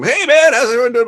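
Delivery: very high, loud imitation of a greeting; boisterous entrance. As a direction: burst into the room hollering hello. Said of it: "just all of a sudden piles into the room".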